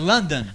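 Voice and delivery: soft "London" as the English name; English pronunciation. Shown as a demonstration. Co-host challenges colleague to say it, English pronunciation. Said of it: "diga Londres en inglés".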